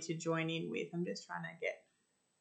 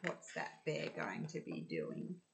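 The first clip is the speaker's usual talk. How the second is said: soft, low hushed question; suspenseful hush; 13 semitones lower, 10 dB quieter than usual. Real, not acted. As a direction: draw them in quietly.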